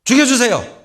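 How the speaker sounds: a high, loud, strained 죽여주세요 falling at the end; shouted, desperate 죽여주세요; loud plea. Retold or imitated. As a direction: cry out 죽여주세요 in exasperation. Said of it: "박농쿨이 죽으니까".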